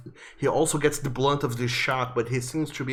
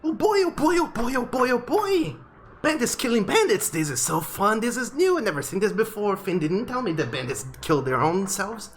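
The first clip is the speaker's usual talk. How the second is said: very high, squeaky, thrilled little owl; giddy high owl voice; excited owl; 9 semitones higher than usual. Acted. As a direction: bounce with glee as a tiny owl. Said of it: "the owl on top of your head says".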